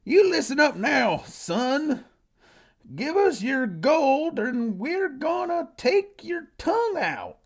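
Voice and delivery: high, loud Southern bandit drawl; heavy southern accent. Acted. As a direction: holler it slowly in a broad Southern accent. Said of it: "said with a heavy southern accent".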